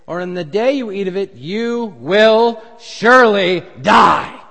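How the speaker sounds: preacher voicing God's command to Adam. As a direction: pronounce it as the Lord's decree. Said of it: "the Lord God commanded the man".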